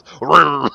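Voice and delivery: a loud throaty engine rev; imitated engine roar. Describing the tractor's sudden getaway, loud mock engine rev. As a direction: growl like a tractor flooring it.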